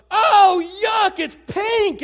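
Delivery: high, loud yelling of disgust; child's disgusted shout. Retold or imitated. Toddler daughter rejecting a pink doll gift, loud disgusted yell. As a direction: shout it out like a kid who hates pink. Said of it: "Reagan looks at the doll and goes".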